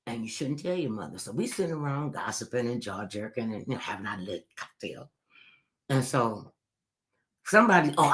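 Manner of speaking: ordinary talk, nothing marked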